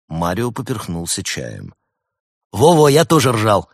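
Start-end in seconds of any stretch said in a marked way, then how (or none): 2.5-3.7 loud, high, lively agreement; perking up. A narrator's reading. Константин eagerly joins in about the laughing, perking up. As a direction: jump in loudly, suddenly animated. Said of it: "оживился Константин".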